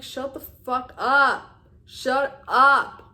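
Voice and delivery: mock-fed-up yell, leaning long on UP; exasperated retort. Younger self snapping back at self-love advice, exasperated.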